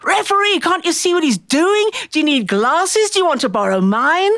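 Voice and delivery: high-pitched mock heckle of an elderly aunt; yelling old aunt. Aunt heckling the referee at wrestling, hammed-up shrill heckle. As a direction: play the outraged old lady at ringside. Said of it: "she was saying".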